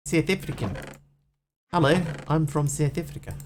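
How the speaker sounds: put-on South African accent, introducing yourself; mock South African vowels. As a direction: do a playful South African impression. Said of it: "her accent as South African".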